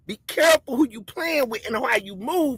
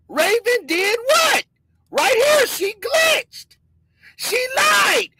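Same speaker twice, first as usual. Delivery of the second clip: much higher, urgent call-out; excited; 10 semitones higher, slower than usual. Real, not acted. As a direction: sound the alarm about her. Exposing Raven in the clip.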